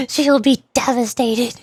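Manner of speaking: normal talking voice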